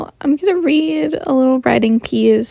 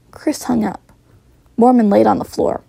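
ordinary delivery

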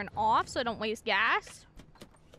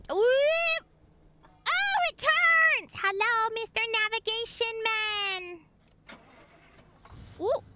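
excited delight; 8 semitones higher than usual. Real, not acted. Player greets the turning navigation device, excited delight. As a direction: squeal it, then greet the gadget in a high voice.

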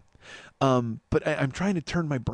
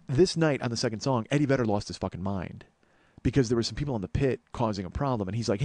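ordinary delivery

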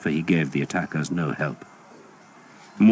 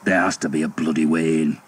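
growled. A narrator's reading. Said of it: "he growled".

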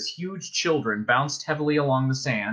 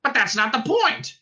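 high-pitched angry protest; raging; 10 semitones higher, 3 dB louder than usual. A narrator's reading. Ford objecting to the soothing voice, raging. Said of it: "raged Ford".

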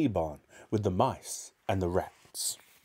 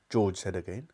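ordinary delivery, nothing marked

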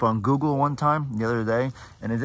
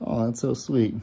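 ordinary delivery

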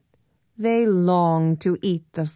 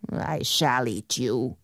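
low troll threat; 6 semitones lower, 5 dB quieter than usual. Acted.

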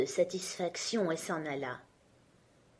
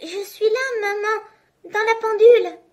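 put-on very high little kid's voice, louder at the end; tiny goat calling from the clock; small thin voice; 13 semitones higher, 8 dB louder than usual. A narrator's reading. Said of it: "une petite voix fluette". (petite voix fluette, très aiguë)

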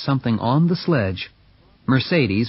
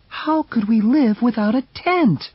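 a higher, fretful lady's voice; fussy wife protesting to strangers, higher; high pleading Mercedes; 11 semitones higher than usual. Acted. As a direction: plead for the tent, pitched up. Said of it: "asked Mercedes".

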